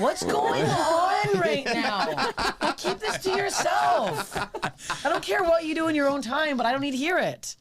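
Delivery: high, laughing shocked outburst; half-laughing, mock-scandalized and high; laughing disbelief. Retelling her shocked reply in bed.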